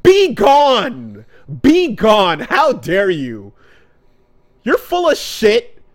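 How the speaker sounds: hammed-up Naruto banishing her; mock outraged banishing. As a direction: drive her out with hammed-up fury. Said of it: "and he goes".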